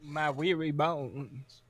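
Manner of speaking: put-on weary old man.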